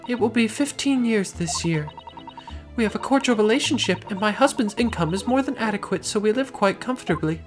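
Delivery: high-pitched refined lady. Acted. Client describes marriage.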